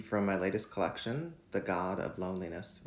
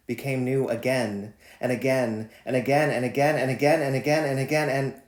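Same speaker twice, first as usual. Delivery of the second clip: building intensity; 5 semitones higher, 9 dB louder than usual. Acted. Repeated again climbing in poem.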